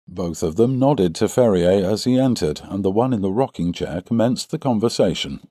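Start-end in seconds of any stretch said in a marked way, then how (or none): none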